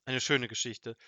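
usual speaking voice

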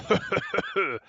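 loud, high mocking laugh; gloating laugh. A narrator's reading. Robber laughs at Seppel eating, gloating. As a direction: laugh in scorn at the victim.

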